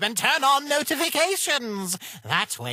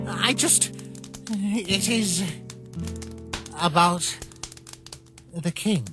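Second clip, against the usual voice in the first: hesitant confession; 3 dB quieter than usual. Acted. Hesitant broaching of subject.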